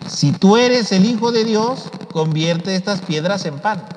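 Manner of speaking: high, slow tempting devil. Retold or imitated. Devil tempting Jesus in the desert, slow high taunt. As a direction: coax him like the tempter. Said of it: "el diablo ... lo empieza a tentar".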